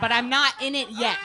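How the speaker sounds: ordinary talk, nothing marked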